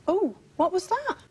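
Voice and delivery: high startled gasp of a question. Real, not acted. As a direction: jump at a sudden noise. Sudden noise during the séance.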